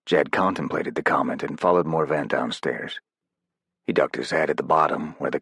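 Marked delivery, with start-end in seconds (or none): none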